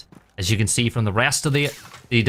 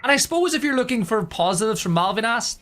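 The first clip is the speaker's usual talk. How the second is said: usual speaking voice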